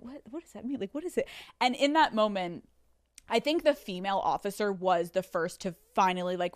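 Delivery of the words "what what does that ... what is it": soft, hushed confused question; half-whispered mock confusion. Officers whispering to each other, hushed. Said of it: "they're whispering to each other".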